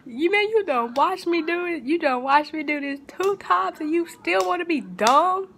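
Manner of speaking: high, angry scolding yell. Retold or imitated. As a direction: tear into her like an angry older sister. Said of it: "they was up in there yelling".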